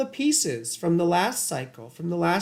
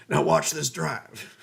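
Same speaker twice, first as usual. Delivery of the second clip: George W Bush quote; slower than usual. Retold or imitated. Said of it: "George W. Bush".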